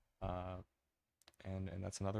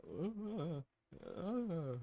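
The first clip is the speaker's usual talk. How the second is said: high panicked stammer; frozen in sudden fear; 10 semitones higher than usual. Retold or imitated. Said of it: "and, and you're like".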